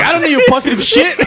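high, laughing mock outburst; mock angry stranger. Friend imagines the stranger's reply, laughing. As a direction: fling it back, half laughing. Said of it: "It's like".

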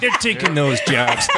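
playfully aggrieved, drawing it out with a laugh; mock outrage. Joking about British actors taking American work, mock outrage.

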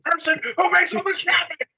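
high, fast unhinged rant; Dennis Reynolds. Retold or imitated. Streamer imitates Dennis Reynolds raging at traffic, high and fast. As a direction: rant like a man losing it over traffic. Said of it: "I turn into Dennis Reynolds".

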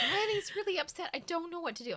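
high, worried mock voice; worried brother. Brother calling about upset sister, high fretful. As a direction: imitate the fretting little brother. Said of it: "He calls me and he's like".

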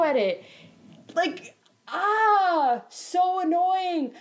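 high-pitched, slow and drawn-out venting; exasperated rant. Real, not acted. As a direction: stretch the words in whiny frustration. Ranting about the coworker's refusal.

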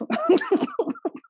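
a sudden, very high self-mocking laugh; high burst of laughter. Real, not acted. A double Scorpio laughing at herself, high laugh. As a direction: laugh high and bright at yourself.

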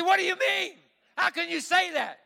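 high, loud, indignant outburst; a frightened son snapping at his father; angry retort. Retold or imitated. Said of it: "at first he got mad at me".

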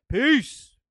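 loud, high peace-out; shouted show sign-off. Real, not acted. Host signing off the show.

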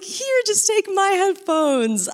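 high, drawn-out retold offer; insistent offer. Giving her headphones to a stranger, high and insistent. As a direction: hand it over in a high, slow voice.

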